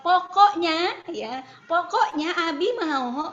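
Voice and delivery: mock bossy dad laying down the law; authoritarian. Father overruling his child on what he wants, authoritarian.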